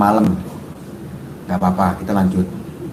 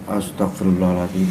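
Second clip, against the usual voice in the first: ordinary delivery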